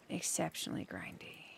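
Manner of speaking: a whispered exceptionally grindy; whispered aside. Real, not acted. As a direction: breathe the words out under your breath. Musing on the grindy behemoth.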